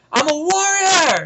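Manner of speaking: loud, high, drawn-out shout; hammed-up warrior yell; defiant war cry. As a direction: holler it slowly like a battle cry. Villain's defiant cry in retold scene.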